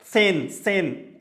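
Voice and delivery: सेन, सेन drawn out slowly, ts at the start; teacher demonstrating German सेन slowly; slow German pronunciation. Shown as a demonstration. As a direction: spell out सेन slowly, twice. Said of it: "उसके स्थान पे क्या हो गया?".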